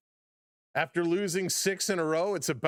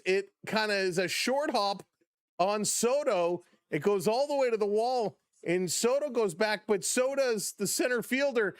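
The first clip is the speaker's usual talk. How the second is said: normal talking voice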